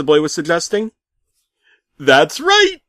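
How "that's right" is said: high, loud bark. Acted. Pizza man barking at the narrator, high and loud. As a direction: snap it back hard. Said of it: "he barked back".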